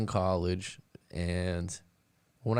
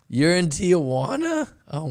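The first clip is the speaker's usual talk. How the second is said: high, loud and slowed in disbelief; stunned you're-kidding question; surprised; 9 semitones higher, 8 dB louder than usual. Real, not acted. Streamer reacts to caller being in Tijuana, surprised.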